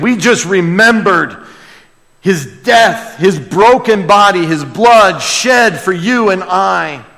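impassioned pulpit preaching; preaching at full force. Acted. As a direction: preach it out to the church.

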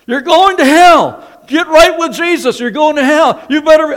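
high, loud hellfire shouting; street preacher kids yelling. Retold or imitated. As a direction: yell it at the kids off the bus. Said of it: "And here are these Baptist kids".

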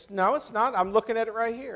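mock the lady's firm denial; insistent contradiction.

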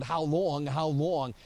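ordinary talk

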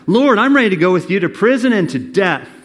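loud, high, ringing declaration; Peter's brave promise, preached loudly; bold vow. Acted. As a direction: proclaim it like a fearless disciple. Peter's vow, read at full force.

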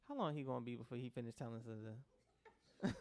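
imitating a bored listener, low and soft; a listener grumbling about the sermon, low; low mocking congregation member. Congregant mocking the preacher's long sermon, low and soft.

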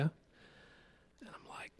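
hushed slow whisper. Retold or imitated. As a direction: breathe it out like an aside. Aside while woman asks for help.

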